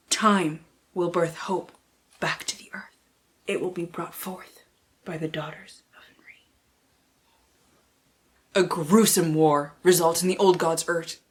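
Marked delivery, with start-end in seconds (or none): 0.1-6.4 dramatic reading. Acted. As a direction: breathe it like an ominous prophecy. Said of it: "so I can get real dramatic here".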